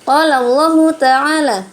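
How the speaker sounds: slow, loud melodic tilawah; Quran recitation. Acted. Teacher reciting a verse for pupils to follow, chanted slowly. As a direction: draw out every vowel as in tajwid recitation.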